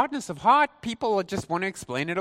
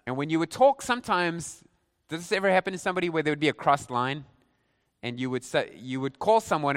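usual speaking voice

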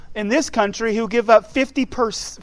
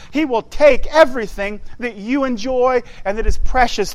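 louder and louder, "take" punched each line; building preaching; 3 semitones higher, 5 dB louder than usual. Acted. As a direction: preach the list, rising to a near shout. Preacher building a warning list.

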